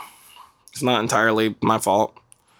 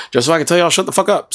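loud, fast, fed-up blurt; loud, fast telling-off; exasperated outburst; 6 semitones higher, 3 dB louder, faster than usual. Real, not acted. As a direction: snap it out at the bickering crowd. Exasperated rant at bickering voters.

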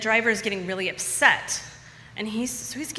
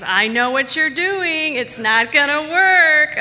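high, loud, slow knowing taunt; friend's teasing call-out; 8 semitones higher, 11 dB louder, slower than usual. Retold or imitated. Friend David teasing her on the dance floor, high, loud and slow. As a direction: call her bluff over the music. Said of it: "he looked at me and he goes".

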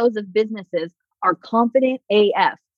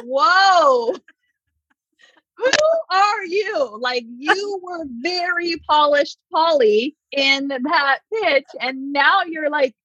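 much higher, slow, laughing disbelief; stunned, giggling who-are-you; shocked host; 8 semitones higher, slower than usual. Retold or imitated. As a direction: react like a host caught off guard. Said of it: "And they're like".

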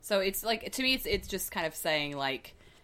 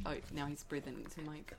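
ordinary talk, nothing marked

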